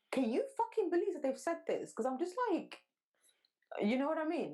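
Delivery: normal talking voice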